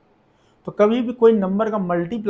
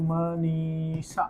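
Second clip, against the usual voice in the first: slow, softer syllable-by-syllable dictation; drawn out; 2 semitones lower, 5 dB quieter, much slower than usual. Real, not acted. Teacher sounding out a name while writing, drawn out. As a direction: stretch out each syllable of the name.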